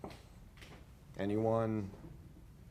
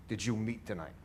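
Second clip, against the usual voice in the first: normal talking voice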